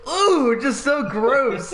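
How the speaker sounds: high, loud disgusted "ooh"; appalled groan rising high; grossed out. Retold or imitated. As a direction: recoil in disgust, drawing out the ooh. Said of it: "I'm like".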